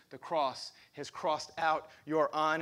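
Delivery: ordinary talk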